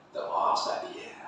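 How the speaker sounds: soft whispered polite refusal; hushed, voiceless aside; whispered deferral. Retold or imitated. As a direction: whisper it low, handing the job back. Acts out relative deferring to the ustaz.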